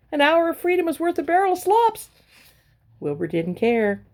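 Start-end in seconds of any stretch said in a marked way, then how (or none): 0.1-2.1 much higher goose proverb; goose lecturing. A narrator's reading. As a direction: preach it like a wise goose. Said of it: "honked the goose".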